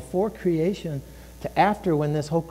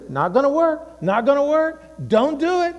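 higher, warning don't do it; nagging inner voice; 8 semitones higher than usual. Retold or imitated. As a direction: nag yourself like a doubting voice. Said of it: "this voice inside of you here that says".

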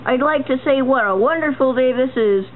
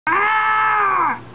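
high scream; 10 semitones higher than usual. Acted. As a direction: scream in defeat. Defeated villain yells.

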